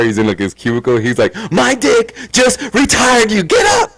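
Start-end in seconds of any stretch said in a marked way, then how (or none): 1.5-3.9 slow, high, loud proclamation; triumphant shout. Retold or imitated. Son telling dad he is retired, triumphant shout. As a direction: announce it like a victory.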